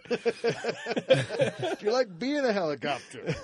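slow, high, laughing through it; laughing question. Pretend. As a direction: giggle out the question. Repeats the bit, laughing.

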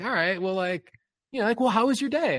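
high, fast, prissy date voice mimicked; mocking the date. Date asking about my day, high mock voice. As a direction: lampoon her fake-polite small talk. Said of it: "she's like".

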